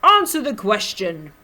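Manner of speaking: big booming demand; very loud, commanding character shout. A narrator's reading. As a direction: boom it out like a huge marshal. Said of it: "boomed Zeno".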